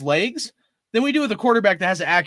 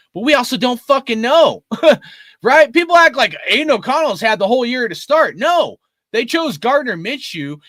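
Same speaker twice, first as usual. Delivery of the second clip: fired up; 5 semitones higher, 6 dB louder than usual. Real, not acted. Heated point with a laugh.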